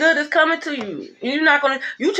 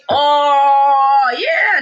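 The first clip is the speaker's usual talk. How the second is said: taunting outcry; 4 dB louder than usual. Real, not acted. Reader taunts a liar about to be clowned, loud and drawn out. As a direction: draw it out loudly, then jump up in pitch. Mocking someone caught lying.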